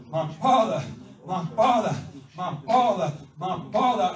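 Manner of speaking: loud, fervent chant-like repetition; exultant preacher's shout; impassioned cry. Acted. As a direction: cry it out harder from the pulpit, over and over. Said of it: "the Spirit in you's going".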